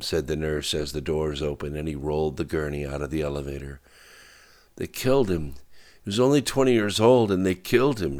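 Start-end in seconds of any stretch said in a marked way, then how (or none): 4.8-8.2 higher, anguished protest; understated tearful outburst, speeding up; crying. A narrator's reading. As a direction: blurt it out in rising grief. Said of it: "she said, crying again".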